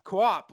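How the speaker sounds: "quap" said with a hard kw and a flat clipped ah; mock Boston pronunciation. Shown as a demonstration. Showing how Sheen says a word, a mock "quap". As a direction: spit out "quap" like a bad Boston accent. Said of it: "Martin Sheen's accent is just all over".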